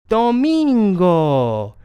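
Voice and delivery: domingo high, loud and drawn out; triumphant answer. Shown as a demonstration. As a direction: call out do-MIIN-go slowly, falling at the end. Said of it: "And Sunday is".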